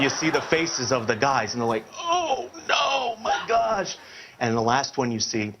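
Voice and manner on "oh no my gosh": high, drawn-out mock wail of dismay; horrified group reaction. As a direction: wail it slowly in comic shock. Guys react to huge bar tab.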